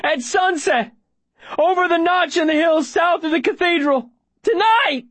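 very high, sustained frantic yelling; desperate shout. Acted. Boy giving up the attack plan, desperate shout. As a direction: scream out every detail to be heard. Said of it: "I shout".

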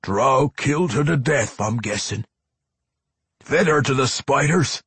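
high, loud dwarf; grim dwarf vampire report; Pwent. A narrator's reading. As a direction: deliver bad news in a forceful dwarf brogue.

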